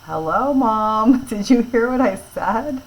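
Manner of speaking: laughing mock impatience at mom ignoring you; exasperated call to mom. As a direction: call out to a mom who isn't listening. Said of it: "you're gonna say".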